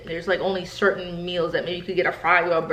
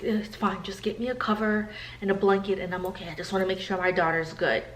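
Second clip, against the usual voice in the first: softer, humble mom waving it off; mom's soft reply; 6 dB quieter than usual. Retold or imitated. Mom softly tells nurses she's fine, humble and quiet. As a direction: gently brush off the offer.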